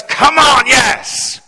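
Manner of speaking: high, loud whoop of approval; exultant full-voiced cry; excited shout. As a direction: imitate a fired-up minister cheering on a prayer. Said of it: "He just gets excited".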